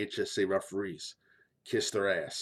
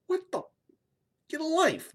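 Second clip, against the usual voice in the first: much higher incredulous outburst; high-pitched what-the reaction; exasperated disbelief. Real, not acted. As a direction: react like it's unbelievable. Reacting to kids told "you suck.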